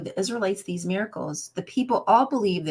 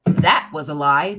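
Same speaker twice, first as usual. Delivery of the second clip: loud verdict; 3 semitones lower, 9 dB louder than usual. Shown as a demonstration. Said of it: "Maury Povich says".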